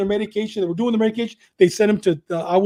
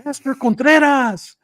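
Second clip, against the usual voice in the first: drawn-out, higher-pitched shout of a name; imitated yell; 5 semitones higher, 4 dB louder, slower than usual. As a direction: mimic someone hollering across a prison yard. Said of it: "all of a sudden somebody would yell".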